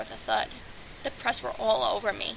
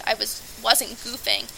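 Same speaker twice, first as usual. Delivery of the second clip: ordinary delivery, nothing marked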